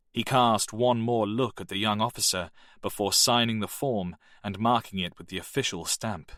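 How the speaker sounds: usual speaking voice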